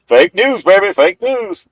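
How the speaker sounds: high loud hammed-up chant; mock slogan shout.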